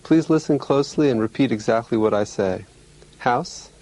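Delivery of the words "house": usual speaking voice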